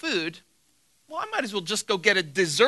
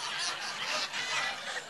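breathy laugh; 9 semitones higher, 11 dB quieter than usual. Real, not acted. Laughing after shaming Lewis.

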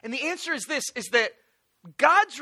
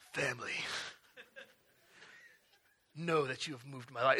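very low, hushed, solemn; deep epic voice; 10 semitones lower, 12 dB quieter than usual. Acted. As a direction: farewell note read in a deep movie-hero voice. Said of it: "something in a deep voice".

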